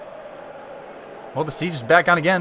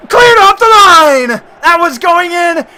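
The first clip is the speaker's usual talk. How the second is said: much higher, loud play-by-play yelling; excited shouting commentary; 12 semitones higher, 14 dB louder than usual. Real, not acted. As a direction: scream the goal-line chaos like a live caster. Goal-mouth scramble, live commentary.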